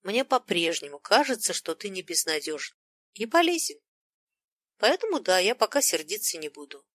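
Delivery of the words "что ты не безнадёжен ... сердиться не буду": usual speaking voice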